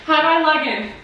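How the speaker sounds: high, giddy, shaky with nerves; nervous laughing. Real, not acted. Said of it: "I'm shaking".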